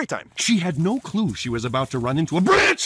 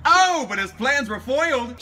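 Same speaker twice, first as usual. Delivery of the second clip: normal talking voice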